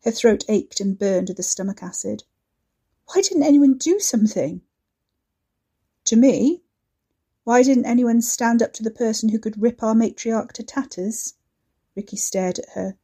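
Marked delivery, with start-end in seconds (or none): 3.1-11.3 raised, accusing voice; angry outburst. Acted. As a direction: hurl the question at him. Katy furious accusation at Ricky.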